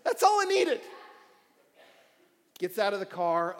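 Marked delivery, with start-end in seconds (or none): 0.0-0.8 loud crying wail, much higher; mock-tearful howl of regret; anguished lament. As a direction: wail in frustration at just missing it. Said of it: "I was like".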